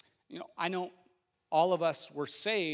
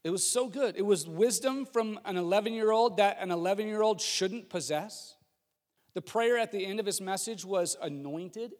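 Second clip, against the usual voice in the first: normal talking voice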